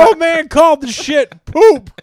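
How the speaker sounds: put-on high, loud old man. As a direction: cackle it like a cranky old man. Joking about old man voice.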